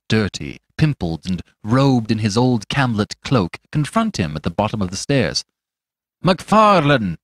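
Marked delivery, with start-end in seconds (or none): 6.2-7.2 a loud call of the name; plain call. A narrator's reading. Fetz first hailing the doctor, loud call. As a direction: call him out once. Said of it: "repeated Fetz".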